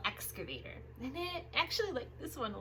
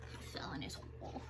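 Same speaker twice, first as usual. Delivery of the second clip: fast hushed whisper; whisper aside; 9 semitones lower, 11 dB quieter, much faster than usual. Acted. Aside whisper, picture comment.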